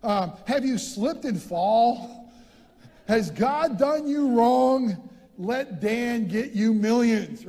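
loud, slow hammed-up injury-lawyer pitch; billboard lawyer ad. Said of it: "he's been saying this".

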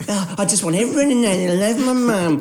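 high, mock-gushing poster voice. Mocking people who post about loving mum, gushy. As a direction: parody someone gushing over their mum.

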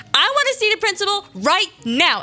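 put-on demanding kid. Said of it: "I was pretty aggressive".

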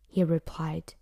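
ordinary delivery, nothing marked

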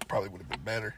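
normal talking voice

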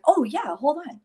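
high, loud, quick "oh yeah"; a son's sudden realisation, bursting out; eager quote. Retold or imitated. Son answering his tearful mom, eager and high.

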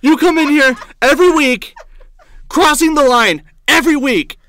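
loud, high imitation of an upset rant; shaken, near-tearful yelling. Barista berating a comic who crossed the line, shaken yelling. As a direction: mimic someone yelling while all shook up. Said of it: "like where you're yelling at someone".

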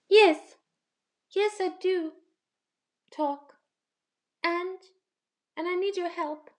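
small high bee voice, eager; cute bumblebee. Acted. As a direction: pipe up like a tiny helpful bee. Bumblebee character voice.

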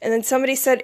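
ordinary talk, nothing marked

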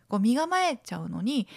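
ordinary talk, nothing marked

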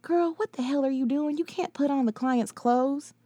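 high, sharp disbelieving scold; a shocked friend calling her out; alarmed scolding friend. Acted.

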